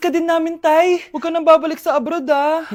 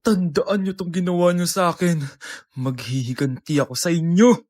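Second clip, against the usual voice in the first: much lower menacing vow; vengeful villain; 8 semitones lower than usual. Acted. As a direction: swear revenge darkly and low. Abandoned child vows revenge. (mababang nagbabantang boses ng paghihiganti)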